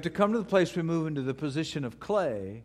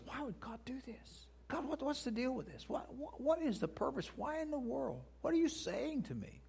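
high, much softer, pleading questions; anguished questioning of God; 10 semitones higher, 9 dB quieter than usual. Acted. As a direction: plead up high, almost under your breath, troubled. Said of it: "we have this civil war that rages inside of us".

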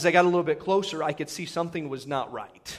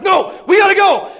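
very high, loud shout; frantic, insistent outburst; freaking out; 15 dB louder than usual. Retold or imitated. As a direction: yell it in a retold panic. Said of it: "stop freaking out".

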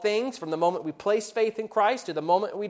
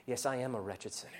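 much softer, lower, calm confession; quiet, restful acceptance of grace; humble reply; 6 semitones lower, 12 dB quieter than usual. Acted. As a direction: answer the accuser gently and humbly. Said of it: "we can respond".

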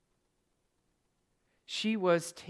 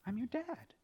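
jealous dad; 8 semitones higher, 10 dB quieter than usual. Retold or imitated. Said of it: "I jealously guard that title".

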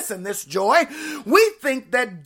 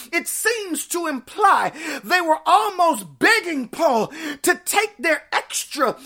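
ordinary talk, nothing marked

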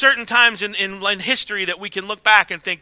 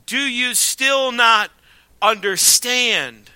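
slow rebuke; much slower than usual. Acted. Jesus rebukes the disciples.

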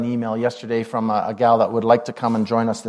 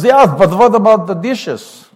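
loud, high, slow German uncle; exaggerated old German uncle's objection; 7 semitones higher, 7 dB louder than usual. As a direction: protest slowly in a mock German accent. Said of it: "in his German voice".

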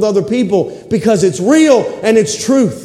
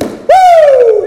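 high exultant whoop; joyful woo; 6 dB louder than usual. Real, not acted. Preacher rejoicing mid-sermon, high exultant whoop.

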